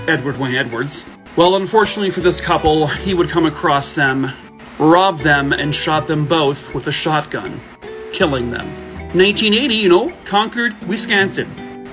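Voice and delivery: ordinary talk, nothing marked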